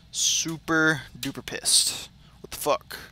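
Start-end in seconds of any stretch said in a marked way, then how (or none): 2.4-3.0 breathy, hushed disbelief; whispered what the fuck. Real, not acted. Gamer whispers in disbelief at the cutscene. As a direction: mutter it under your breath.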